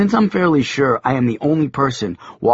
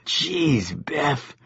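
trailing off leaving; 3 semitones higher, 6 dB quieter than usual. A narrator's reading. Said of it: "he was saying as he left".

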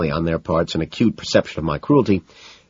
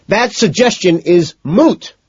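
high, loud, slow scream; exasperated scream; 14 semitones higher, 7 dB louder, slower than usual. A narrator's reading. McDermott shoots down a friend's idea, exasperated scream. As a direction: scream each word out slowly. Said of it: "McDermott screams".

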